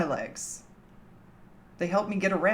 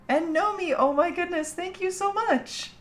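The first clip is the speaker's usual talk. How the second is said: much higher, gushing thank-you; 11 semitones higher than usual. Real, not acted. Streamer thanking Nomi in a high gushing voice. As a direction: melt over the viewer's kindness.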